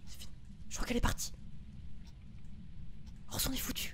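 frightened breathy whisper; whispering while hiding. Real, not acted. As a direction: whisper from your hiding spot. Hiding, unvoiced.

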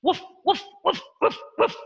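short staccato woofs; dog barking. Shown as a demonstration. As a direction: imitate a dog with quick, separate barks. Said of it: "Like a dog".

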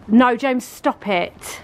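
usual speaking voice

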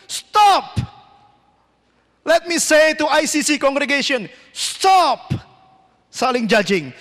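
high, emphatic exhortation with shouted commands; forceful preaching. Real, not acted. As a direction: command the congregation like a fired-up preacher. (khotbah berapi-api, lantang)

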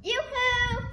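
high cartoon mouse greeting; Minnie Mouse voice. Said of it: "Minnie Mouse here".

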